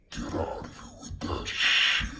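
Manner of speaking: put-on very low, slow old-man voice; mock angry old man. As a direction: imitate an old man ordering someone out. Retelling the old man's angry words.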